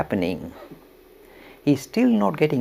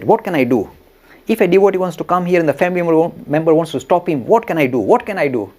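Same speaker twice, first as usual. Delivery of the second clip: louder, fast, exasperated pleading; agitated pleading; 4 semitones higher, 8 dB louder than usual. Retold or imitated. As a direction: throw up your hands, what can I do. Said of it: "looking very agitated".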